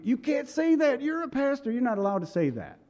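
high, prissy imitation of a critic; mock scolding critic. Churchgoers scolding the pastor, high mocking imitation. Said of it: "people will get down on you".

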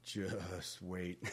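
low, hushed mock threat; mock trash-talk comeback. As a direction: answer the taunt under your breath. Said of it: "you'd be like".

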